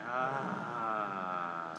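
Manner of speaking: a soft, audible breath-out sigh; guided sigh. Shown as a demonstration. Leading the room through breathing, soft audible sigh. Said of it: "out with a sigh".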